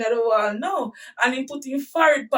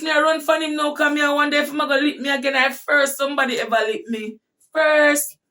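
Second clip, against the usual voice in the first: usual speaking voice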